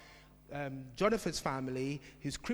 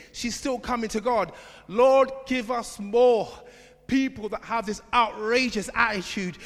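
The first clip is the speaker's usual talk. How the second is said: full-force preaching; 6 semitones higher, 9 dB louder than usual. Acted. Preacher rousing the congregation, loud full-force preaching. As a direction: preach it out to the whole church.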